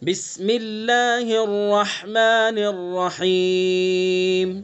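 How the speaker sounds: slow, drawn-out chanted recitation; measured tajweed chant; Quran recitation. Shown as a demonstration. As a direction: recite each word slowly and melodically. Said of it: "اس میں ہم غلطی کیا کرتے ہیں".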